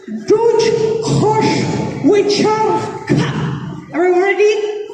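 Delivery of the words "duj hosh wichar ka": Ka, la, klingan, mach said syllable by syllable, clear and careful; Klingon pronunciation. Shown as a demonstration. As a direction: teach the Klingon line slowly. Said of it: "this second sentence is pronounced like this".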